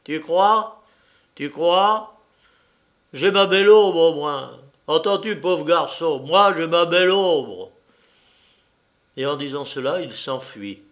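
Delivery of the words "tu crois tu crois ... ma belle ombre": loud, higher, crowing mockery; gloating taunt. A narrator's reading. The little one boasts of his shadow to the poor fellow, gloating. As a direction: taunt a rival with boastful relish.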